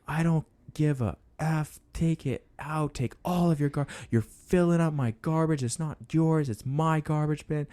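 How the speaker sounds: high-pitched imitation of a furious neighbor; mock yelling. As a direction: rant high at ordinary volume, as if quoting him. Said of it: "like, yelling at me".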